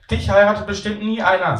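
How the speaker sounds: a girl mocking the grown-up; teasing little girl. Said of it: "sagt Maria und lacht".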